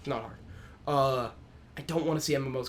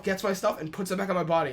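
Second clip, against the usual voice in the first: normal talking voice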